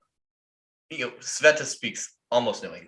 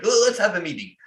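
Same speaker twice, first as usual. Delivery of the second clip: mock boss; 10 semitones higher, 5 dB louder than usual. Mimicking Vlad again.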